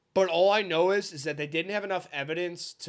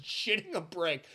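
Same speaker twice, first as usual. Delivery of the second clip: ordinary talk, nothing marked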